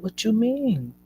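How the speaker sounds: high, swooping demand to explain; alarmed question. Retold or imitated. Mother questioning staff about baby moving down, alarmed.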